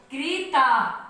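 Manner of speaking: 'क्रीता' क्री की लंबी ई के साथ; 'क्रीता' में क्र नहीं, क्री बोलो; क्री उच्चारण. Shown as a demonstration. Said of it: "इसको हम क्री पढ़ेंगे". ('क्रीता' साफ़ क्री के साथ)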